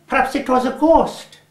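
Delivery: put-on a boy still thrilled by the fright; a hint of a spooked, thrilled boy; breathless wonder. Jim after fleeing the house.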